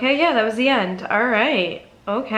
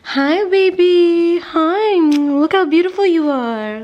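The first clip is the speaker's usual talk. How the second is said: much higher, louder, slow doting coo; gushing pet talk; 7 semitones higher, 5 dB louder, slower than usual. Real, not acted. Gushing over her dog, high and slow. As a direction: squeal it lovingly to your puppy.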